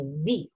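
"V" as low buzzing "vee"; teeth-on-lip V. Shown as a demonstration. Teacher explains lip and teeth, low buzzed V. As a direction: rest teeth on lip to start "V". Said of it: "Como los dientes".

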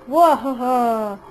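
slow evil cackle; hammed-up villain laugh. Villainous laugh in Town Square.

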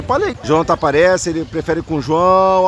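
ordinary delivery

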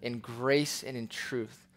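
low, hushed public prayer. Real, not acted. Pastor closing a prayer for his church, prayerful.

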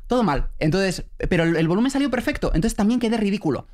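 ordinary delivery

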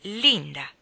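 protest, hurt that she didn't notice; offended exclamation. A narrator's reading. Stanley to Linda, stung she forgot, loud hurt cry. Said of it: "Stanley era molto offeso".

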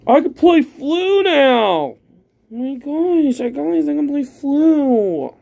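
thrilled discovery to viewers; excited. Real, not acted. Excited at pulling card.

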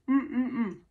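humming with mouth full. Real, not acted. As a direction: mm through a mouthful of food. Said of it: "As I'm talking with my mouth full of food".

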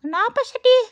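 high, loud cat voice; questioning cat. Pretend. Cat questions sad Cici, high loud cat voice.

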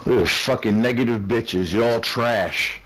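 usual speaking voice

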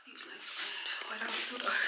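real soft whisper. Real, not acted. Upstairs waiting for the client, whispering. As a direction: whisper the plan to herself. Muttering at the lights.

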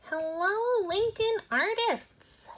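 high, loud, breathy queen teaser; Queen Penguin voice. Acted. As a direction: hype the sequel as the queen. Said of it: "Queen Penguin here".

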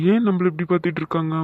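ordinary delivery

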